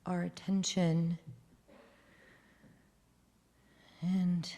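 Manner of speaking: soft, hushed prayerful voice; solemn prayer. Acted. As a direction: lead a quiet moment of silence. Moment of silence, prayer.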